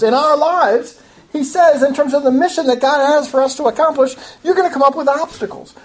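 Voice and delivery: lifted, emphatic sermon voice. Real, not acted. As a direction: drive it home to the congregation. Preacher drives the point home.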